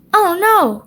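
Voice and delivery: high worried oh no; gasp. A narrator's reading. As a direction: catch the breath in dismay. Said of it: "gasped Peppa".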